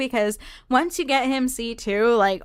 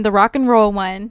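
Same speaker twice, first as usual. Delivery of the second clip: ordinary talk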